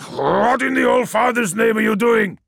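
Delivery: high, loud furious yell; yelled demand. A narrator's reading. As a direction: shout at the sorcerer in outrage. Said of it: "yelled Biaki".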